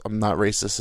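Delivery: usual speaking voice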